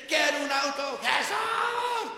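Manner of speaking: high, loud pleading to Jesus; mocking a believer's loud, high begging prayer. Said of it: "He visto personas que dicen".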